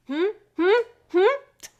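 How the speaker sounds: mock-prying rising hums; suspicious questioning. Detective awaiting a suspect's answer, playfully suspicious. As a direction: prod a suspect with each hum.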